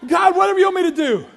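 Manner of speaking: high, fast shouted plea; desperate surrendering prayer. Retold or imitated. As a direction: cry it out to God in one burst. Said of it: "I'm praying".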